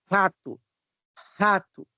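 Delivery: Rato with a breathy throaty h-like R; strong R. Shown as a demonstration. Teacher presenting the R sound, Rato with aspirated R. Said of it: "o R tem um som forte".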